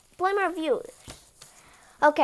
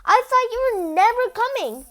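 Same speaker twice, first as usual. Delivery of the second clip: relieved character; 9 semitones higher, 7 dB louder than usual. Acted. Character greets writer.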